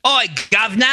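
high-pitched British street greeting; mock British accent. Correcting what the pub guy said, mock British accent. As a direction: hail someone in caricature British. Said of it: "He probably said governor".

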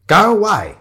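high, loud rebuff; annoyed dismissal. Acted. Shiloh to intruding photographer, annoyed. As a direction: send a pest packing.

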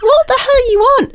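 high, incredulous outburst; outraged. Retold or imitated. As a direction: let the disbelief shoot up high. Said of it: "And I thought".

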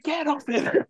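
high-pitched mock protest; imitated protesting caddy. Co-host acting out caddy watching the tackle, protesting. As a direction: plead it high, as a comic retelling. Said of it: "He was like".

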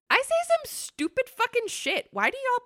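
high, loud mock despair; exaggerated high exclaim. Real, not acted. Repeats the line, exaggerated self-mockery.

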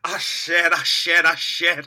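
hint of a loud southern drawl; lightly voiced Foghorn. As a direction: bark it like a cartoon rooster. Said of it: "That's what he sounds like".

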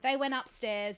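ordinary delivery

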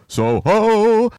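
high, drawn-out call of Soho; hunting cry. Retold or imitated. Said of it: "he would shout".

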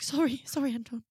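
sorry, sorry, backing off with a grin; a hammed-up apologetic squeak; mock apology. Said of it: "I was like".

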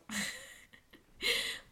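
squealing high breathy laugh. Real, not acted. Reacting to the friend's joke, high laugh. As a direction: burst out laughing.

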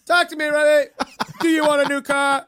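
mock Oprah.

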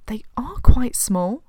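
whisper. Real, not acted. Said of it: "whispered point".

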